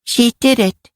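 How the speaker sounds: put-on high little-girl voice; a child's high voice, recounting gravely; little girl Karen, solemn. Karen tells of Cassie's sacrifice, solemnly.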